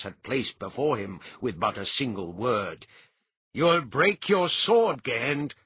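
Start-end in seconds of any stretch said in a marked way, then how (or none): 3.5-5.5 louder, slow stern warning; cool-headed firm warning; plain-spoken warning. A narrator's reading. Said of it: "forcing all traces of archaism".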